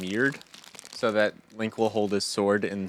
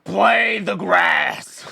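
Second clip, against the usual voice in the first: loud, high, dragged-out bellow; mock demanding shout; 7 semitones higher, 9 dB louder, much slower than usual. Girl ordering a song on grass, hammed-up shout. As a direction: shout it as an over-the-top slow command.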